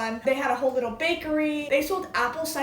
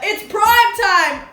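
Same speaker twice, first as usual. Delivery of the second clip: much higher and louder, shouting the catchphrase; shouted catchphrase; 8 semitones higher, 11 dB louder than usual. Pretend. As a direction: belt it out like a horror fan.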